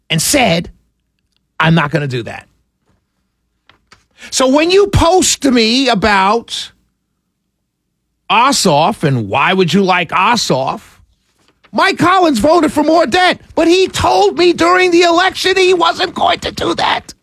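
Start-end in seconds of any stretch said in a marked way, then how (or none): 1.6-2.5 low, settled refusal; firm refusal. Retold or imitated. Quoting dissenters' refusal. 11.7-17.1 high, indignant protest; real exasperated complaint; heated indignation. Real, not acted. Angry rant at politicians.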